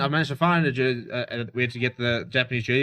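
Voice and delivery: ordinary talk, nothing marked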